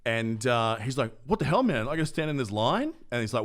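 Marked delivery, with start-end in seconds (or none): 1.3-2.9 indignant complaint. Retold or imitated. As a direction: protest like an entitled man in a queue. Impatient customer complaining in queue.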